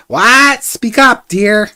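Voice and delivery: put-on loud, high, hard-of-hearing old woman; loud old lady. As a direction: call out like a deaf granny. Deaf old herbalist asks again.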